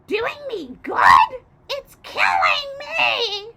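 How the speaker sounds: high, loud, slow shrieking hag; scream. A narrator's reading. Grandma screams at the boy, slow and high. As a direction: drag out a furious shriek. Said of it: "she screamed".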